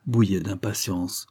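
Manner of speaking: ordinary delivery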